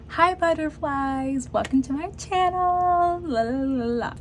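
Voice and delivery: high, bright, sing-song YouTuber greeting; bubbly channel intro. Retold or imitated. Imagining the perfect intro she dreamed of, a high bubbly sing-song greeting. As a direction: gush like a cheery host, trilling the la la las. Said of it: "always had in my mind that I would be like".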